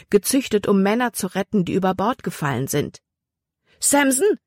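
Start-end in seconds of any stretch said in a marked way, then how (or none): 3.8-4.4 high, sharp command to the dog; commanding call. A narrator's reading. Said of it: "befahl Libby".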